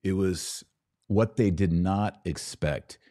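ordinary delivery

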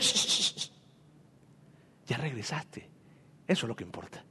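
very soft, low shushing whisper; quiet him gently, nearly breathless; hushing whisper. Acted. Father hushing his penitent son in a soft whisper.

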